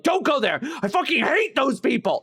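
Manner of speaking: high, loud mock outrage; ranting driver. Uber driver warning riders off the Strip's venues, mock ranting. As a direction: imitate someone venting with relish.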